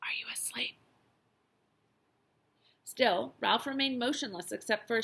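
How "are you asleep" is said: soft, secretive whispered question; hushed bedside whisper. A narrator's reading. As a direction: whisper it so nobody else hears. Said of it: "whispered the boy".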